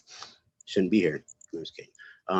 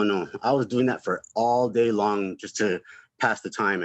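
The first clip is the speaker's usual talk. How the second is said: ordinary talk, nothing marked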